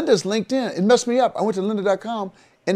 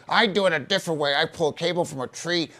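mock boastful viewer, lightly put on; know-it-all viewer; 2 semitones higher than usual. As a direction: poke fun at a viewer bragging about his method. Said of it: "I'm so tired of all these stupid people".

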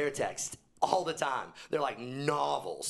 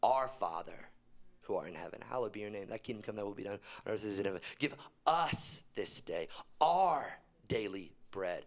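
reciting prayer; 4 semitones lower than usual. Retold or imitated. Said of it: "he says things like".

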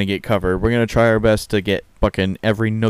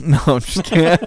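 laughing; 7 semitones higher, faster than usual. Real, not acted. Laughing after a joke.

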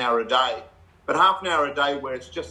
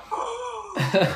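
high-pitched gasp of delight, imitated; mock starstruck gasp; imitated awed gasp. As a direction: mimic a room gasping in admiration. Retold amazed dressing-room reaction.

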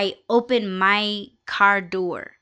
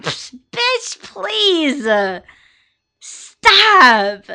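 high, loud psh bitch please, stop; sassy dismissal; 8 semitones higher, 8 dB louder, much slower than usual. Retold or imitated. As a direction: wave it off with full sass, pitched way up.

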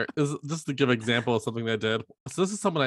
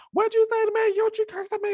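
much higher, put-on indignant coworker voice; mocking the coworker; 4 dB louder, faster than usual. Mocking the coworker who argued with her, high and indignant. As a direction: squeak it out like an offended coworker.

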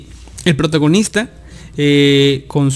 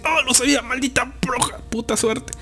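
put-on high, softened grumble in a girl's voice; mock cursing; 9 semitones higher, 6 dB quieter than usual. Other girl learning of her rival, mock cursing. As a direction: imitate a sore rival cursing under her breath.

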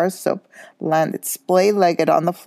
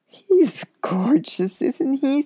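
doting; 9 semitones higher, much slower than usual. A narrator's reading. Hermione dotes on the cat.

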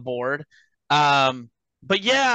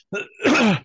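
rough throat clearing after choking; choking on a drink; 8 semitones higher, 4 dB louder than usual. Real, not acted. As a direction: clear the throat hard, half coughing. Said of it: "I was choking on my drink there".

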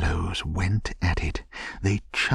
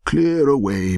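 loud, high, jovial command; cried out; 8 semitones higher, 8 dB louder than usual. A narrator's reading. Old master ordering apprentices to make room, hearty shout. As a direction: holler the order to the lads. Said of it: "cried old Fezziwig".

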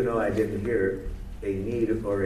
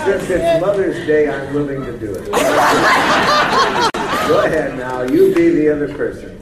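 laughing loud; 5 semitones higher, 13 dB louder than usual. Real, not acted. Playful reply, laughing audience.

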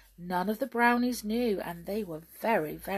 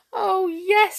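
high, loud tearful cry; sobbing; 12 semitones higher, 9 dB louder than usual. A narrator's reading. Queen grieving for Perenelle, sobbing. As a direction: wail it as a distressed queen. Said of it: "sobbed the queen".

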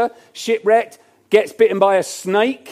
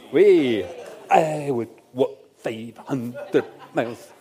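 Scottish accent; 5 semitones lower, slower than usual. Shown as a demonstration. Preacher quoting the Proclaimers to the congregation, slow mock Scottish accent. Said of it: "apologies for the Scottish accent".

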